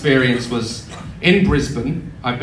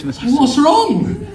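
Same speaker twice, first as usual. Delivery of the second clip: raised-pitch mock concern; lightly voiced receptionist; 13 semitones higher, 4 dB louder than usual. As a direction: imitate an alarmed hotel receptionist. Receptionist answers the panicking guest.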